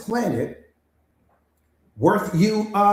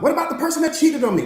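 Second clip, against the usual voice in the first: higher, complaining voice of a wounded student; hurt student; 8 semitones higher than usual. Acted. Coach voicing a student who was left, higher and complaining. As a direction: plead your hurt to the coach.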